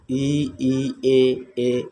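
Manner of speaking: ई and ए each said twice, long and clear; vowel demo. Shown as a demonstration. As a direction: repeat ई and ए distinctly. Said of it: "जिनका उच्चारण जीभ के अग्रभाग से हो". (ई और ए दो-दो बार स्पष्ट बोलना)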